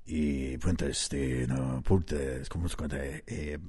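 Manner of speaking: mumbled under the breath; mumbling. Acted. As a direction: mumble it shyly, barely audible. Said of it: "You seem to be mumbling".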